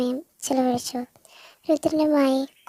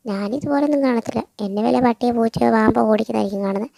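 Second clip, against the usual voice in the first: ordinary delivery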